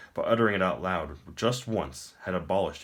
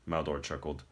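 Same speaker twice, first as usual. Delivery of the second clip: ordinary delivery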